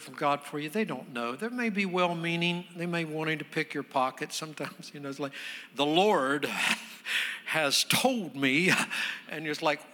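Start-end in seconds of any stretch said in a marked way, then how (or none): 5.8-8.7 slow, portentous mock prophet; mock prophetic pronouncement. Preacher imitating dubious word-givers, mock prophetic. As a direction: pronounce it grandly, with a long pause.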